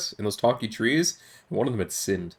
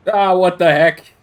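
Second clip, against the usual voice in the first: exasperated; 5 semitones higher, 8 dB louder, slower than usual. Real, not acted. Exasperated at the puzzle.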